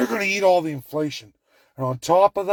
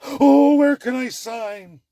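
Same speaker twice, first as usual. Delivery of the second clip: high gush with a loud drawn-out start; hammed-up eager cry; mock eagerness; 6 semitones higher, 4 dB louder, slower than usual. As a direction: imitate an over-keen leader jumping at it. Voicing Starmer eager to sign deals.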